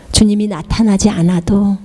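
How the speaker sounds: usual speaking voice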